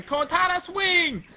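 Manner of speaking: high battle cry; attack cry. Acted. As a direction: call out the move as it swings. Acted attack cry in story.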